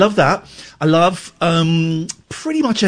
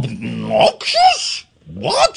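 mock indignant; 9 semitones higher, much slower than usual. Real, not acted. Repeating the insult, indignant.

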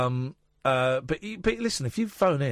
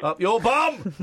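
loud, high echo of the caller; mimicked sign-off; 9 semitones higher, 5 dB louder, much slower than usual. Host echoing a caller's cheeky sign-off, mock shout. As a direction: throw it back higher and louder.

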